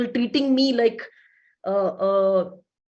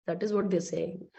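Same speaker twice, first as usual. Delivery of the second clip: normal talking voice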